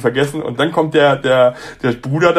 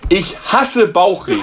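high, drawn-out mock yell of the bride; mock outburst, pitched up and stretched out; retold shout; 5 semitones higher, slower than usual. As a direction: imitate a bride yelling it across the hall. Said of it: "schrie rum".

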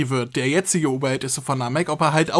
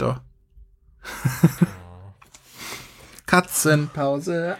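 ordinary talk, nothing marked

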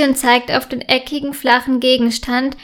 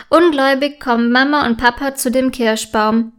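ordinary delivery

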